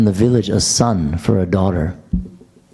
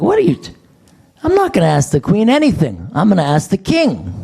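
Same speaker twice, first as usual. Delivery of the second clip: indignant; 8 semitones higher than usual. Retold or imitated. Mock indignant retort to guard.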